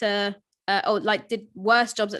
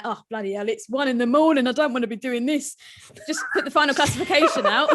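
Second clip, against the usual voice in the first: fed-up steward, higher as it builds; grumpy steward voice; 3 semitones higher, 3 dB louder than usual. Acted. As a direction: moan like a bloke down the pub. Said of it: "I love that steward voice".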